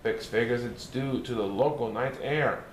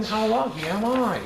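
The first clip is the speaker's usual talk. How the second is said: cheerful exclaim, a bit louder and higher; shouting; 6 semitones higher, 3 dB louder than usual. Retold or imitated. Narrator urging the listener, shouting for joy. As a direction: cry it out as a happy boast. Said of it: "you ought to be shouting".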